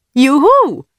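put-on high, loud girlish cheer; joyful whoop. A narrator's reading. Girl hears the radio is coming back, whooping high and loud.

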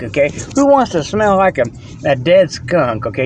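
ordinary talk, nothing marked

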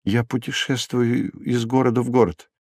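soft, bashful explanation; shy answer. A narrator's reading. Wandering prisoner explaining to procurator, shyly. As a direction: explain it modestly, keeping the voice small. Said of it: "застенчиво ответил арестант".

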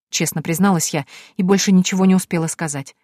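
ordinary delivery, nothing marked

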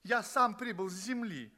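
high, stammering protest; indignant outcry. Acted. Earth guest protests to the examiner, stammering and indignant. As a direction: burst out, flustered and stumbling. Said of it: "я вскричал".

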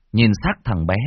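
ordinary delivery

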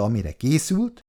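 plain reading voice

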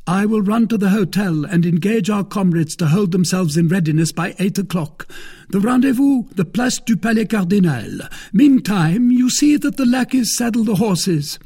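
loud, high-pitched character voice; Porthos. A narrator's reading. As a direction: pitch it well up and project heartily.

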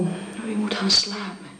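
gentle whispered comfort; soothing whisper. Acted. Housekeeper whispering the sick wife to sleep. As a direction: hush her toward sleep.

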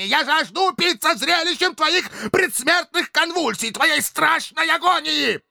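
tirade climbing to a very high frenzy; beside himself with rage. A narrator's reading. Dictator refuses pity and demands death, frenzied. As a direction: build from outrage to a shrieking bloodlust. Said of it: "выходя из себя от бешенства".

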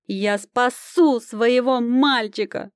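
high, slow, impassioned outcry; sudden exclamation. A narrator's reading. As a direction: vow fervently to save your child. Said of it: "вдруг воскликнула Прохоровна".

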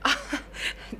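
short breathy laugh of surprise; surprised laugh. Acted. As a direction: laugh as you recognise him. (überraschtes kurzes Lachen)

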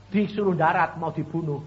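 ordinary delivery

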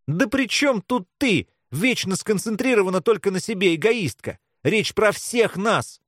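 high-pitched exasperated outburst; angry cry. A narrator's reading. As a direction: throw it at her, fed up. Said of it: "крикнула Белка". (раздражённый выкрик)

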